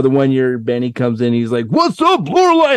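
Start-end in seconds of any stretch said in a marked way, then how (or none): 1.7-2.7 very high-pitched imitated crowd greeting; high, whooping mock hello; hyped greeting. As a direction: mimic a guest hyping up the room.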